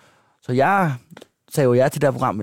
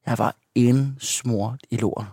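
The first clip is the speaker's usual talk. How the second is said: usual speaking voice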